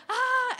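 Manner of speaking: high, loud mock scream; both of us screaming in fright; panicked scream. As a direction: let out a laughing shriek of panic. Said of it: "we're both like".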